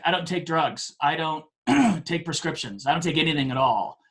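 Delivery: ordinary talk, nothing marked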